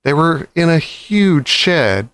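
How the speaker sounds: usual speaking voice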